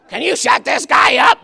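mock complaint, shouted; annoyed neighbour.

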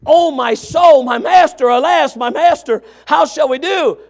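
loud, high panicked cry; preacher's hammed-up shout of despair; alarmed servant. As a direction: cry out to your master in dread. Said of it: "he comes to his man of God and says".

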